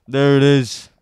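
loud excited cheer; triumphant shout. Real, not acted. Finds the code, repeats triumphantly.

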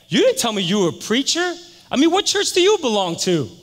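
loud, high, astonished demand; incredulous challenge. Retold or imitated. Harry finds out Tony is a preacher, loudly incredulous. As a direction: confront them in loud disbelief.

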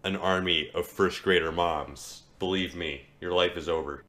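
ordinary delivery

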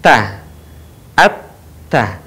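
a light, thin "ta" sound. Shown as a demonstration. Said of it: "posisi lisannya terbuka".

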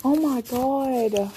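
ordinary delivery

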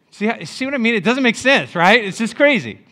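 loud and high, driving the point home; emphatic preaching. Real, not acted. Preacher rousing the congregation about strict rules, loud and high.

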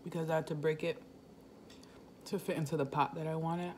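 speaking between slurping bites; talking while chewing noodles. Real, not acted. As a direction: mouth full of noodles. Seen 2: Forkful of noodles held at and hanging from mouth throughout.

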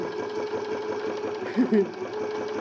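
real nervous giggling; nervous giggle. Real, not acted. Demonstrator unsure about her hand sewing on air, nervous giggle. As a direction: giggle through the nerves. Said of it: "'cause she's giggling nervously".

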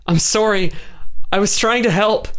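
put-on a young woman pleading she meant well; upset Kay. A narrator's reading. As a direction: defend herself in a high, anxious voice.

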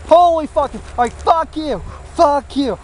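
high mock yell of fuck you at the laptop; screaming at laptop. Yelling at his laptop when the upload dies, high angry cursing. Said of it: "I started screaming at the fucking laptop".

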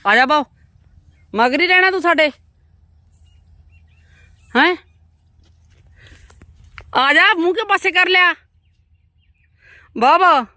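high, loud sing-song call to Bab. Real, not acted. Calling Bab to come along. (ਉੱਚੀ ਆਵਾਜ਼ 'ਚ ਬਾਬ ਨੂੰ ਬੁਲਾਉਣਾ)

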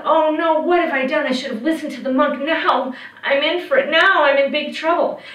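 high, loud panicked thought; terrified. A narrator's reading. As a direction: fret in a rising panic. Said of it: "He was terrified".

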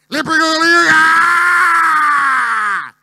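commentator screaming, very high and loud; Mariano Clos. Acted. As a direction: yell it and hold the goal scream. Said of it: "no puede gritar una cosa así". (relator gritando un gol a todo pulmón)

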